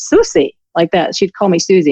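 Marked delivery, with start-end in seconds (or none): 0.0-0.6 put-on mother's Austrian accent. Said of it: "really cute, actually, Au-, Austrian accent".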